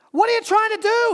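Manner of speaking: high, loud alarmed yell. Acted. Man on bridge yells down at the dangling stranger. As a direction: shout it down in alarm. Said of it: "he yelled".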